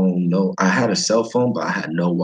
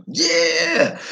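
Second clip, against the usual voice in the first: high, eager broken English; mock accent, pitched up, rising; imitated Vietnamese English. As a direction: coax the guest to eat. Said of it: "He's like".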